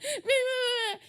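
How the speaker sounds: high, loud whiny gibberish taunt; mock teasing. Imitating a sibling squabble, exaggerated whine. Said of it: "he was bullying or teasing me".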